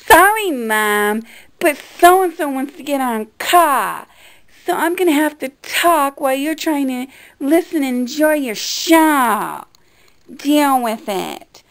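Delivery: loud, drawn-out hypothetical back-talk to mom; mock disrespectful. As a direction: act out brazenly talking over your mother. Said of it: "disrespect my mother and go".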